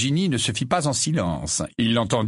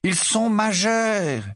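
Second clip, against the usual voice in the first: slow, tired reminder; 11 semitones higher, much slower than usual. A narrator's reading. Husband calmly reminds wife, slow and tired. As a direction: say it worn out. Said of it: "de la même voix fatiguée".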